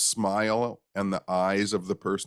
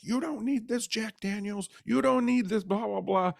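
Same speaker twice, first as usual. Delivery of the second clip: high, shrill mock scolding; screaming wife; 14 semitones higher than usual. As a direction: scold him like a furious wife. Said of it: "she was screaming at him".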